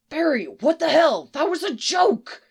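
loud, high, scandalised outburst; indignant, emphatic scolding; outraged hiss. A narrator's reading. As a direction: react in high-pitched disbelief, forcefully. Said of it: "Sisko hissed".

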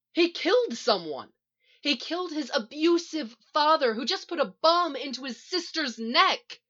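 high, heated shout. A narrator's reading. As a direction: fire back in outrage. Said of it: "Iris shouts".

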